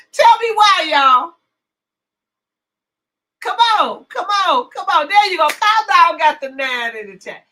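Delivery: high, loud call to the audience; insistent shouting for a response; loud urging. Real, not acted. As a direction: egg them on at full volume. Calling on the chat to answer.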